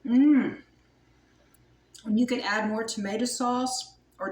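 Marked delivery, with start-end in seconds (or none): 0.0-0.6 a loud, pleased hum of taste; loud savoring mmm. Real, not acted. Cook tasting her cabbage roll, loud pleased mmm. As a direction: savor the first bite out loud.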